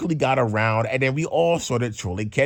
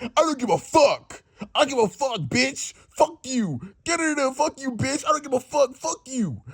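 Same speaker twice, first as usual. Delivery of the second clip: high mock furious Kenya, cursing; a hammed-up high angry coworker; imitating Kenya raging; 11 semitones higher than usual. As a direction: play her losing it, pitched way up. Voicing furious Kenya at Natasha.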